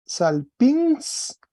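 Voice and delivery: salpins said high with stress on the final syllable; Greek pronunciation. Shown as a demonstration. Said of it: "tiene un acento agudo en la A". (salpins, dicho alto con acento final)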